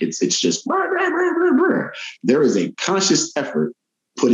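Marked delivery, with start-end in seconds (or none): 0.0-1.9 high-pitched brr of flowing speech; high motor brr. Shown as a demonstration. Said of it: "They just speak".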